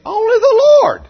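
full-throated revival preaching; preaching at full force. Acted. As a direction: build it up to a big praise shout. Preacher building to a shout.

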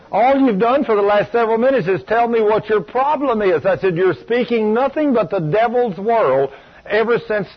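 high, heated telling-off; exasperated rebuke, acted out; retold scolding. Scolding the caller after screaming.

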